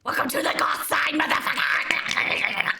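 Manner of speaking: hammed-up dark welcome, slowing right down on the final word; goth greeting. Streamer voices her goth basement greeting viewers with mock menace. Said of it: "And then it's like".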